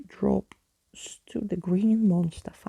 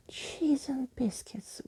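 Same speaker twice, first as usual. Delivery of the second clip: breathless slow whisper; awed whispered exclamation; 6 semitones higher, 6 dB quieter, slower than usual. A narrator's reading. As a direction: hush it out in wonder. Keys emerging excited.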